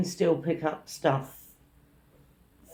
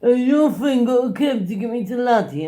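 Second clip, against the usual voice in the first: high, loud mimicked demand; obnoxious yelling; 8 semitones higher, 7 dB louder than usual. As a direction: keep the whole question at a pestering shout. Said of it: "that's how much you were yelling".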